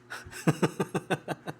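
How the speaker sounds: soft airy laugh; quiet giggle; breathy laugh. Real, not acted. Laughs at child.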